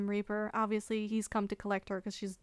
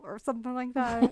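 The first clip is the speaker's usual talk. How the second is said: ordinary talk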